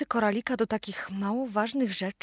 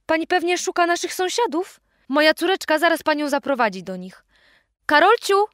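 higher, louder mother calling out; 6 semitones higher, 4 dB louder than usual. A narrator's reading. Mother calls Karolcia to guide the stranger. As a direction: call the daughter from the next room.

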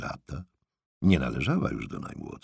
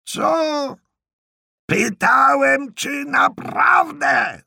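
full-force high shout, stretched out; exasperated repeat at the top of the lungs; bellowed question; 8 dB louder, much slower than usual. Acted. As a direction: bellow it slowly for a deaf listener. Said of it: "żeby wrzeszczał jak ona".

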